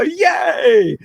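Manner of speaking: put-on high childlike cheer; naughty little child. Shown as a demonstration. Leader models childlike playfulness for the class, high cheer. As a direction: cheer like a gleeful naughty kid. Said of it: "a naughty little child".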